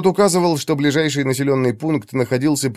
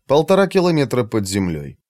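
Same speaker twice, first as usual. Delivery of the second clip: ordinary talk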